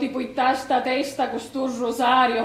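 steady murmured prayer recitation; praying the rosary. Acted. Neighbours pray the rosary together, murmured prayer. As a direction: recite the Hail Mary by rote.

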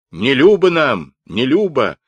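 loud, high defiant shout; insistent protest. A narrator's reading. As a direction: yell the refusal twice. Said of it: "не унимались казаки".

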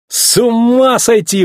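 high, drawn-out amazement; elated, high-pitched wow; exclaiming. A narrator's reading. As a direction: stretch it out, thrilled by your new look. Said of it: "воскликнул Маккуин, смеясь".

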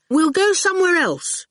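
put-on cheeky boy brushing off a giant; young boy. A narrator's reading.

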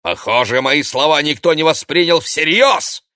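loud outburst rising high; spiteful anger. A narrator's reading. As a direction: vent it angrily at the whole room. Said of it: "злобно выдохнул он".

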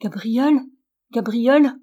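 thin acted call, rising at each end; plaintive call from another room, lightly voiced; weak calling voice. A narrator's reading. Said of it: "une voix faible appela". (appel faible, intonation montante)

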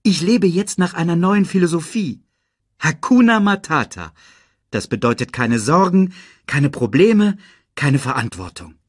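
a hint of a carefree young lion; lightly voiced carefree Simba. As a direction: sell the carefree motto. Simba explains his philosophy.

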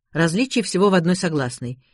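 ordinary delivery, nothing marked

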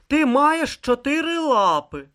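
high, slow, firm rebuff; curt retort. A narrator's reading. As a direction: cut the complaint off, slowly and loudly. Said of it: "відрізав він".

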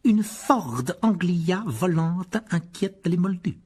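aigu, très lent, méprisant; détacher chaque mot avec dédain; lecture méprisante. A narrator's reading. Lit le titre avec mépris. (titre lu avec dédain)

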